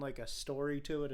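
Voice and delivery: ordinary talk, nothing marked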